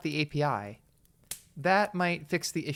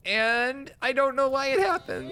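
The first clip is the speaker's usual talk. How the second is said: high-pitched frustrated cry; climbing high in comic despair; exasperated wail; 8 semitones higher than usual. Real, not acted. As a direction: let it rise into a helpless wail. Venting about the broken stream.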